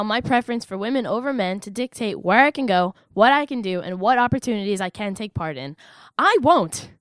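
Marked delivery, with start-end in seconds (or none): 6.2-6.9 defiant. Real, not acted. Defiant resolve.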